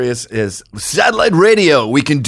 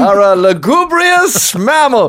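slow, pompous, higher voice; old-timey gentleman; 6 semitones higher, slower than usual. Pretend. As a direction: florid Victorian insult. Mock old-timey insult.

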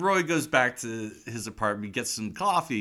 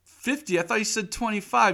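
much higher, whiny protest; robber's complaint; 11 semitones higher, 3 dB louder than usual. Retold or imitated. The robber haggling with Roy, high and whiny. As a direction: complain about the payment. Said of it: "he's like".

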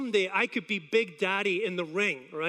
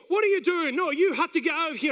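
mildly raised, higher referee's order; scolding referee; 5 semitones higher than usual. Retold or imitated. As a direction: imitate a referee ordering someone out. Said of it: "the referee will go".

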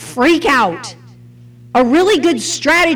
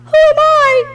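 high question; 12 semitones higher than usual. Shown as a demonstration. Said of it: "if you read it backwards".

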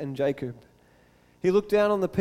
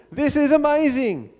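higher-pitched exclamation of wonder; amazed; 8 semitones higher, 4 dB louder than usual. A narrator's reading. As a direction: read it as Moses marvelling out loud. Rereads it after stressing the wonder.

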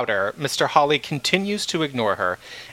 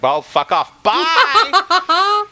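louder, rising into a laugh on bye; mock brush-off; 6 dB louder, much slower than usual. [name] telling the chairman off, loud laughing dismissal. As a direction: toss it off and laugh.

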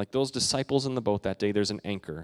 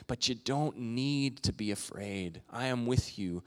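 softer, slow comforting words; tender reassurance; 6 dB quieter than usual. Retold or imitated. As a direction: comfort a frightened listener. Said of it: "Jesus is saying to us".

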